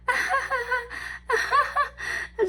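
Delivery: high, anxious giggling; nervous giggle. Retold or imitated. Hearing he's in his fifties, high nervous giggle. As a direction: giggle out the nerves. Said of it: "just giggling away".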